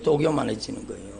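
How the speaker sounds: quiet repeat. Acted.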